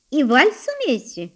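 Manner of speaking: high-pitched mocking question; teasing. A narrator's reading. Elder sister tests the young pianist, teasing. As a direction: needle him with the question. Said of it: "продолжала дразнить его Лидия".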